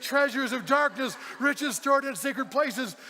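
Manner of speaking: lightly voiced booming reminder, high and loud; preacherly declaiming, each phrase spelled out; voice of God. God speaking in the pastor's voice to worker, lightly declaimed. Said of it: "he sounded just like you".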